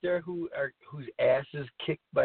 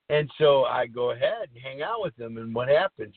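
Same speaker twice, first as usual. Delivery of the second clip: normal talking voice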